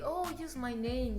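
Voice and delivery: ordinary delivery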